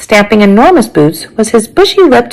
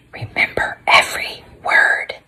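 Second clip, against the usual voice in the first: soft, secretive whisper; hushed whisper in passing; 10 dB quieter than usual. A narrator's reading. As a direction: whisper it as a parting instruction. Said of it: "he whispered to me".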